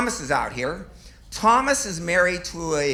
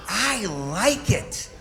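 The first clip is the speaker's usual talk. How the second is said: drawn-out, dreamy I like it; lazy, blissed-out admission; slow savoring; 5 semitones higher, much slower than usual. Acted. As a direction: savor the words as if sedated. Savoring propofol confession.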